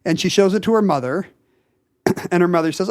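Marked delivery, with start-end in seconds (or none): none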